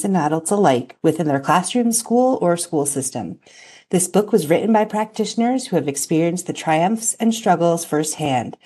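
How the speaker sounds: reading a prepared book blurb; scripted intro read. Acted. Host introducing the authors' book to listeners, read from a script.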